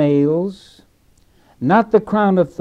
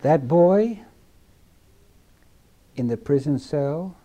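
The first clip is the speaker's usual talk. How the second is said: ordinary talk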